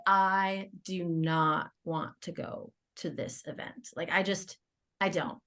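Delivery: slow, heavy, low-energy reluctance; softly drawn-out unwillingness; retold reluctance. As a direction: drag it out softly, dreading the outing. Retold dread of going to event.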